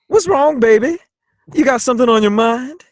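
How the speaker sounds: teasing Flint, high-pitched character voice; player's character voice. Acted. Player's character confronting Flint after hearing him scoff, high lilting character voice. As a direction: pitch it up and lilt into a question. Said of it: "I say".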